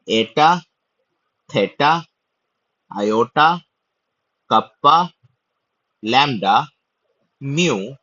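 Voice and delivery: each letter name punched out separately; emphatic teacher's roll call, word by word; repeat-after-me recitation. Shown as a demonstration. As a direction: recite the list for learners to echo. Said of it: "पढ़ता हूं और आप लोग मेरे साथ बोलने का कोशिश".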